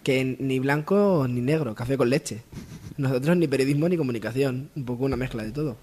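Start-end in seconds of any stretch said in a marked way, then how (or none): none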